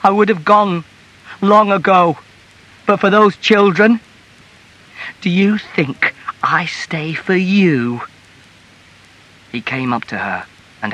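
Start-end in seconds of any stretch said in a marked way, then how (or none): 0.0-8.0 high, raised, anguished protest; tearful outcry. A narrator's reading. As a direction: cry out bitterly, slowing on the final question. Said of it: "she cried in tears".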